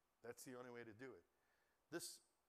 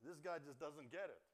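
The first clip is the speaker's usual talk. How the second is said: ordinary talk, nothing marked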